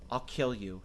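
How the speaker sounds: ordinary talk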